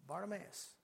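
very soft, unforced name call; hushed call. Shown as a demonstration. Preacher showing Jesus calling over a roaring crowd, hushed. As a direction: call him without raising your voice at all. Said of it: "like, at that tone".

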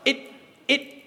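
ordinary talk, nothing marked

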